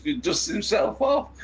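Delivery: usual speaking voice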